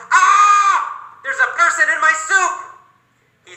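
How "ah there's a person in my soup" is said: high, loud shriek of horror; high shocked shout at a person in the soup; panicked yell. Acted. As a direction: scream it out in disgust, fast. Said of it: "He yells".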